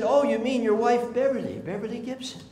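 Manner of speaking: light up at the wife's name; a chirpy clerk brightening; delighted clerk. Retold or imitated. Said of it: "young woman have a nice attitude?".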